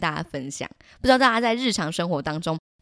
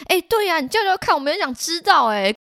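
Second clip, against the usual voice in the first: egging on; 9 semitones higher than usual. Retold or imitated. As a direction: egg him on, cheeky and high. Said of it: "起哄说".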